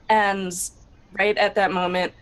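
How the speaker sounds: ordinary delivery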